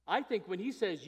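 ordinary talk, nothing marked

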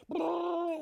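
loud shouted boom imitating cymbals. Imitating resounding cymbals, shouted boom. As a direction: make it crash.

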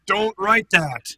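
religious leaders protesting to Pilate, slow and loud. Retold or imitated. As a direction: beg Pilate, slowly, not to write it. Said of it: "They're like".

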